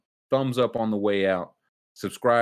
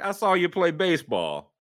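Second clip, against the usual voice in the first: mock taunt; 8 semitones higher, 3 dB louder than usual. Mock heckling Jordan.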